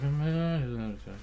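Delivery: imitated muttering. Drugged boyfriend answering about his lost wallet, mock muttering. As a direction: mumble like someone barely awake. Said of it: "he's just muttering".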